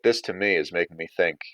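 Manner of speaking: ordinary delivery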